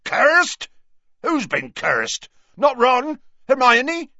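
loud, high alarmed questions; alarmed shouting. A narrator's reading. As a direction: demand to know who, over the wind. Hagrid alarmed, shouting in the wind.

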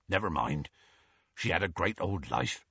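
a big man consoling gently; gruff, low comforting Hagrid. A narrator's reading. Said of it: "he said gruffly".

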